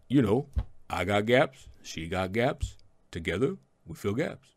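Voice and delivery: low, slurred Rocky voice with rising gaps; a hint of Rocky Balboa, mumbling; Rocky impression. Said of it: "and Rocky talking about Adrian".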